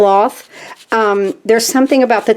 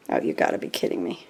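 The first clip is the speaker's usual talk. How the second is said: muttered under the breath, much softer and lower; exasperated mutter; 14 dB quieter, faster than usual. Real, not acted. Crafter muttering to herself about clogged bottles, exasperated under breath. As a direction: grumble it to yourself, fed up.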